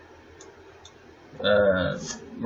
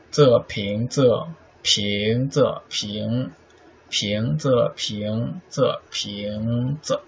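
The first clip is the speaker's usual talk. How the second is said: 仄 cut short, 平 drawn out in 仄平仄; measured pattern, long on 平 and brief on 仄; short-long tonal rhythm; slower than usual. Shown as a demonstration. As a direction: slowly beat out 仄平仄, stretching each 平. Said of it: "Short, long".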